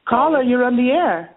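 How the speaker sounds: high, breathy sultry caller greeting; porn star voice. Acted. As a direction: purr it like a seductive hotline host. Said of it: "my porn star voice".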